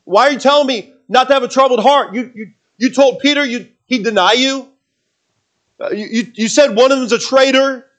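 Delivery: a disciple pushing back in disbelief; protesting disciple. Acted. Disciple objects to Jesus, loud and incredulous.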